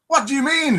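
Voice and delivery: hammed-up pirate that misses the accent; a pirate in a joke, voiced half-heartedly high; failed pirate accent. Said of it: "that wasn't for my pirate accent".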